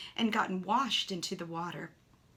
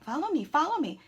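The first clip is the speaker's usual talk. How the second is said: higher, louder duck plea; voicing the duck; 6 semitones higher, 6 dB louder than usual. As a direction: call out like a duck begging you to follow. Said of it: "as if to say".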